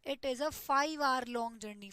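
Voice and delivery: slow, each word spaced; slow corrected example; slow dictation. Shown as a demonstration. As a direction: stress the correct form slowly. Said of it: "तो यहाँ पे".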